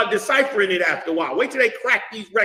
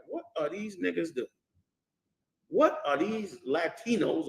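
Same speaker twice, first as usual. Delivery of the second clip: hushed, puzzled muttering of outsiders; mock baffled murmur; 9 dB quieter than usual. Preacher voicing shocked onlookers, hushed mock bafflement.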